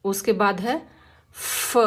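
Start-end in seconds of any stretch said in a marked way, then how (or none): 1.3-1.8 फ as soft air only; just let the air out for फ; breathy f sound. Shown as a demonstration. Teacher modelling the f phonics sound, फ as pure air. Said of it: "इसको हम फ नहीं बोलते".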